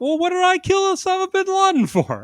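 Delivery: high, loud put-on voice; high character voice. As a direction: squeak it out in a cartoonish voice. Quoting Keenan in sketch.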